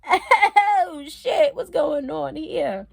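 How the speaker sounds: high, loud, drawn-out retold panic; laughing mock alarm. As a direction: exclaim in comic alarm through a laugh. Retold alarm at seeing DEA jacket.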